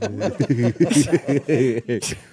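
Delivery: loud burst of laughter. Real, not acted. Reacts to the search result.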